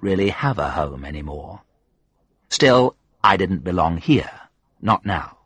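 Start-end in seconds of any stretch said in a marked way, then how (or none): none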